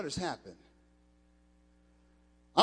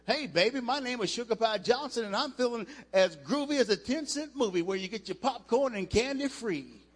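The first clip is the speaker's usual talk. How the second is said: higher, louder, grooving jive patter; smooth jive talker; 5 semitones higher, 4 dB louder than usual. Retold or imitated. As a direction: ham up a smooth seventies cool cat.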